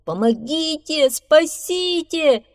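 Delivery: high, loud cries for help; panicked calls; shouting for help. A narrator's reading. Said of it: "закричал бобр". (крик о помощи)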